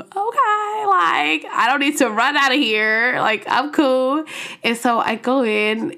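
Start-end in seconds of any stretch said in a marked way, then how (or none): 0.0-4.2 high-pitched mock composure; feigned cool. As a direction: act out trying to convince yourself you're fine. Retold reassuring herself on seeing him.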